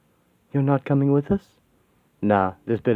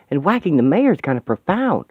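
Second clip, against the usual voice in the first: usual speaking voice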